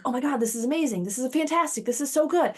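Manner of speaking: thrilled rider on the climb; mock delight. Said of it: "And you're like".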